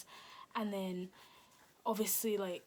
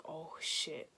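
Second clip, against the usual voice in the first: hushed dismay; 5 semitones lower, 6 dB quieter than usual. Retold or imitated. Realising guard has her phone.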